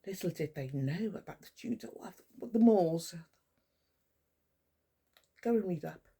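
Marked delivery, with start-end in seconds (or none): none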